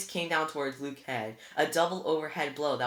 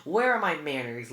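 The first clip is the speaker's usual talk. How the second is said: a smooth, mock-polite welcome; oily charm, playing the gracious host; said smoothly; 2 semitones higher, 8 dB louder than usual. A narrator's reading. Villain Luke greeting his captive guests, smoothly. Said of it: "Luke said smoothly".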